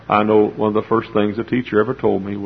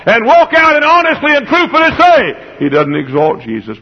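full-force preaching; 10 semitones higher, 7 dB louder than usual. Acted. Preacher's climax.

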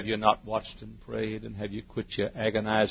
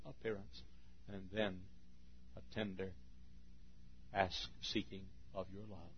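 much softer, gentle; hushed tenderness; 13 dB quieter than usual. Acted. Preacher describing God's tender appeal, soft. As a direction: let it fall to a hush. Contrast: God's sweet manner.

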